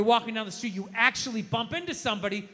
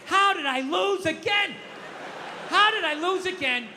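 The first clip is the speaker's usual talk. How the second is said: baffled exasperation; 8 semitones higher than usual. Acted. Acts baffled loser on a walk.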